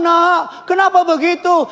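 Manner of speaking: put-on gentle, soft question to a child; soft-spoken parent asking a child why. Shown as a demonstration. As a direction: ask it tenderly, not scolding. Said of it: "ini soft spoken".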